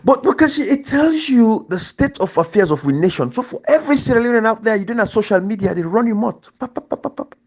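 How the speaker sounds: high, urgent preaching to the listeners; passionate appeal. Real, not acted. Host urging Sierra Leoneans online, fervent and high. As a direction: plead with the whole nation.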